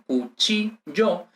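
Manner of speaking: "cuchillo" very slowly as cu, chi, llo; slow, separated cu-chi-llo, pointing out the stressed chi; syllable by syllable. Shown as a demonstration. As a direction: draw out each syllable of "cuchillo". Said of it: "Si la sílaba, eh, tónica".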